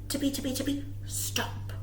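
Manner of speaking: soft whisper of tiptoeing bunnies; whispered sneaking. A narrator's reading. Reading bunny footsteps to children in a whisper.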